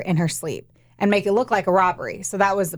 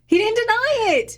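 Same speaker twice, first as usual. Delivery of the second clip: high, disbelieving exclamation; can't believe it; incredulous; 14 semitones higher than usual. Real, not acted. Incredulous retelling.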